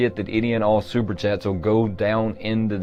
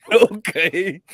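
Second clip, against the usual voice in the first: laughing; 10 semitones higher than usual. Retold or imitated. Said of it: "And I went".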